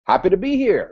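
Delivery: high, loud enthusiastic reply; match their energy, full volume; bright eager welcome reply. Shown as a demonstration. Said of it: "respond in kind".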